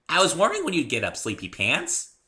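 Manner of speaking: high, loud, fast playful teasing; perky pony voice. A narrator's reading. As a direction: tease him awake like a bubbly pony. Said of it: "very perky".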